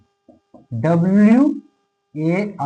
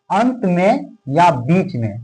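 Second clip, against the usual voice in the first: slow dictation; much slower than usual. Shown as a demonstration. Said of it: "slowed while writing on board".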